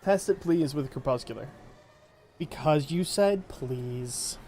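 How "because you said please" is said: drawn-out teasing; slow teasing. Real, not acted. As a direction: stretch it out slowly.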